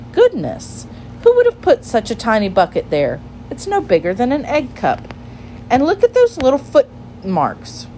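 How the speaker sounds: put-on high-pitched storybook child marvelling; wide-swinging, surprised girl's voice; curious little girl, amazed. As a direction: exclaim in wonder like a small girl.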